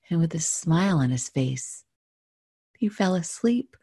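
gentle rhyming storytelling read; story narration. A narrator's reading. Finishing her dream story for viewers, gentle narration.

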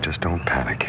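soft, low hushed command; whispered warning. Acted. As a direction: keep her calm under your breath. Calming accomplice while cop nearby.

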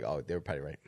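low, soft mock concession; understated imitation of a resigned reply; imitated customer. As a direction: drop low and quiet as the giving-in customer. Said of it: "they're like".

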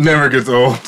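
talking through a mouthful of pork rinds; words round a bite of food; mouth full. Real, not acted. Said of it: "I'm eating".

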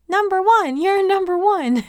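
high, loud cheering call. Retold or imitated. Marshall tossing Sam in the air, high loud cheer. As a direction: cheer him on like a proud dad tossing a kid.

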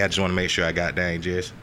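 usual speaking voice

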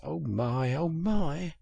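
understated plaintive lament; miserable. A narrator's reading. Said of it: "said the Mole miserably".

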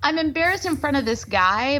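high-pitched, mortified retelling; retold embarrassment. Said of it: "I'm so embarrassed".

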